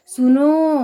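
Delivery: सुनो with a long, loud final vowel; drawn-out call. Shown as a demonstration. Teacher shows how one calls out to someone, drawn-out call on सुनो. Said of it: "अक्सर पुकारते समय किया जाता है".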